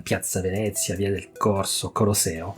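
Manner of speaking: Italian place names with Italian stress; Italian pronunciation. Retold or imitated. His student giving directions in Rome, Italian accent. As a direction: say them the Italian way, quietly listing. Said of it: "elle disait à l'italienne".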